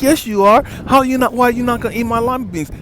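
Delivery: loud, high, fast insistence; insistent retold shout. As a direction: shout her demand back at him, pushy. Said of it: "And she was like".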